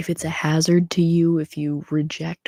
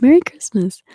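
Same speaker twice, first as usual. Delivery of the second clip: louder and higher, a cheerful second greeting; excited repeat; 6 semitones higher, 12 dB louder than usual. Real, not acted. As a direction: call it out again with real excitement. Greeting viewers, said twice.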